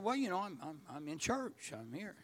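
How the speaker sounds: very soft, low, halting mumble; imitating a small, hesitant excuse; sheepish reply. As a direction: trail away almost to nothing as you answer weakly. Sheepish answer to God, retold.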